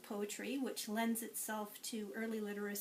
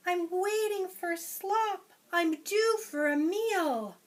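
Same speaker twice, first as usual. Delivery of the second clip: higher, louder impatient hog; 9 semitones higher, 10 dB louder, slower than usual. Acted. Teacher voicing the hungry hog, higher and louder. Hog character quote in story.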